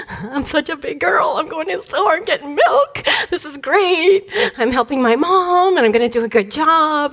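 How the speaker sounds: put-on a small kid bursting with pride; proud little girl. Little girl walking to the store alone, proudly excited.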